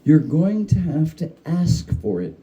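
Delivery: ordinary delivery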